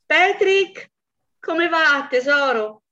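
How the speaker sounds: the wife calls out to the husband she believes is alive, falsely cheerful. Acted. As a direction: call brightly to your husband through the house. Wife calls out on coming home.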